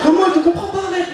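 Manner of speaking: exasperated mum, lightly voiced; a hint of a puzzled mum; mother voiced.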